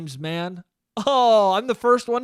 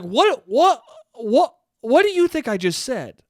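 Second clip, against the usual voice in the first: raised, incredulous repeated what; louder, disbelieving, as if she's lost it; retelling his own baffled outburst at mom; exasperated retort; 3 semitones higher, 5 dB louder than usual. Retold or imitated.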